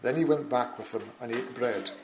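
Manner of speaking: loud, ringing Bible reading; emphatic scripture reading. Acted. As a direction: proclaim the passage to the back of the hall. Reads scripture, growing forceful.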